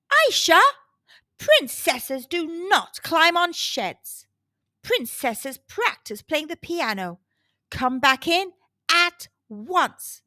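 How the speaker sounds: mum's loud, stern call from the castle; stern queen calling her daughter indoors; booming mum. Acted. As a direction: boom it out like a cross mother. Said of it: "her mum's voice boomed".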